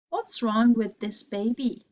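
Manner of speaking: usual speaking voice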